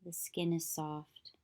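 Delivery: calm, even meditation voice with long pauses; unhurried yoga nidra guidance, leaving long silences; guided meditation. Acted. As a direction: guide them gently into stillness, phrase by phrase.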